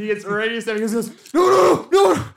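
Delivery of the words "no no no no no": very high, loud frantic cries; strained panic of a wounded prisoner; panicked pleading. Acted. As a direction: beg loudly to avoid another stab. Prisoner threatened with second stab.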